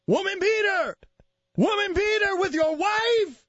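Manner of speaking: put-on high, shouted accented rant; ranting father impression. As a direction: shout it like an irate dad on one note. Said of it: "It's the same accent".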